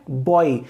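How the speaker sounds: बॉय ends o into a light i glide, mostly o; बॉय को सही diphthong के साथ बोलो; correct pronunciation of boy. Shown as a demonstration. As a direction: say बॉय with a gliding oi ending, leaning on the o. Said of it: "इसका pronunciation क्या होता है?".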